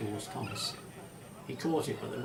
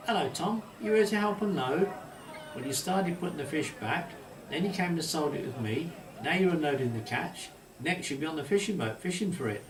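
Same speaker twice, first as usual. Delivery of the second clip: higher and louder, playful teasing; joking; 5 semitones higher, 5 dB louder than usual. A narrator's reading. Fishmonger teasing Thomas about the fish trade, higher and louder. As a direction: rib the boy good-naturedly. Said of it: "he said in a joking manner".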